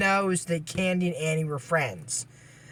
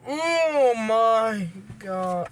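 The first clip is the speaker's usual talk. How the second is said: stunned; 3 semitones higher, much slower than usual. Real, not acted. Horse bolts in the scene.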